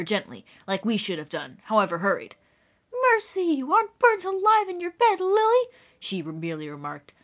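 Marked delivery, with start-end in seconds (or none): 3.1-5.7 very high, exclaiming rustic woman; alarmed country woman. A narrator's reading. Eliza to Lily in bed, high alarmed exclamation.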